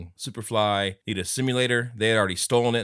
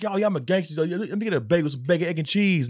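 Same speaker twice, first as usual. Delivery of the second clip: mock gangster; 8 semitones higher than usual. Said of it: "he's like".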